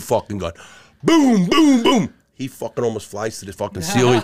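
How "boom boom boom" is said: loud, high booms like shots going off; shouted imitation of gunfire; mock gunshots. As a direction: blast out each boom like a 357 firing.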